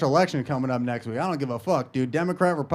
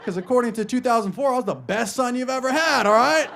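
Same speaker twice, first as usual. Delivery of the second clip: high, loud sarcastic comeback; mock defiant retort; 8 semitones higher, 5 dB louder than usual. Son arguing back at his mom, mock defiant. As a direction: talk back in hammed-up indignation.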